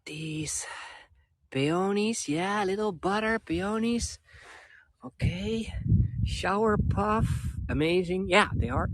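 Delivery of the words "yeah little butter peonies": ordinary talk, nothing marked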